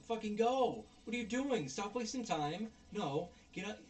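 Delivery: high, urgent reenacted yell; retold exasperated yelling. Viewer retelling screaming at the movie's dawdlers, exasperated yelling. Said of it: "I'm yelling and screaming".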